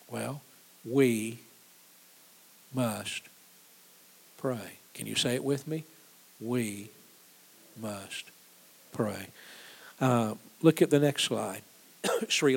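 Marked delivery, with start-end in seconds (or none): 0.9-4.8 "we ... must ... pray", long pause after each word; weighty and measured, "we", "must", "pray" each standing alone; solemn word-by-word emphasis. Real, not acted. Preacher answers after telling of a lost child, "we ... must ... pray" slowly spaced. 6.4-9.3 "We ... must ... pray", evenly spaced and grave; measured group refrain. Real, not acted. Preacher has congregation say it with him, "We ... must ... pray" word by word.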